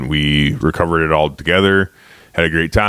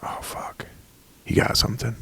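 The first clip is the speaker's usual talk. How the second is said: whispered thought on getting the call; very soft, breath-only aside; hushed retold reaction; 6 semitones higher, 10 dB quieter than usual. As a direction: whisper it to yourself in anticipation.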